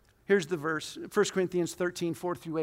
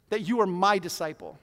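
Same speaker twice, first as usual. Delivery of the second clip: ordinary talk, nothing marked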